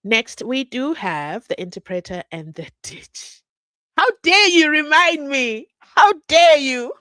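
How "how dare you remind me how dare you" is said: loud, high, theatrical how dare you; hammed-up indignant cry; playfully scandalized protest; mock outrage. Mock outrage at being reminded.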